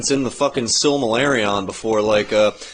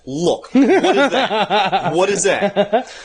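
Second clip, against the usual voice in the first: exasperated god; 6 semitones higher than usual. Retold or imitated. Iluvatar voiced, laughing.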